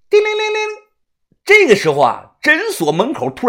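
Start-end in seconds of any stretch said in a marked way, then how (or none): 0.1-0.8 very high-pitched trilled ringing; imitated bicycle bell. A narrator's reading. Storyteller announces bicycle at clinic door, imitated bell.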